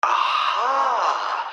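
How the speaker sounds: a sudden, pleased realization; aha, as if it just clicked; triumphant aha. Acted. Riddler gets it at last, a triumphant aha.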